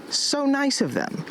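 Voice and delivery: high, slow mock gratitude; sarcastic. Real, not acted. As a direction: drip with sarcasm. Sarcastic about abandoned box.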